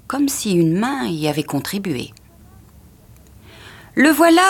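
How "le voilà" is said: high, loud exclamation of discovery; crying out. A narrator's reading. Wife spotting the lost parcel, excited cry. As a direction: burst out loudly on the find. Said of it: "s'écria-t-elle".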